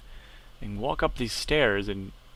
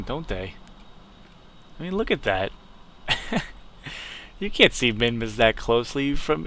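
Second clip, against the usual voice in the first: usual speaking voice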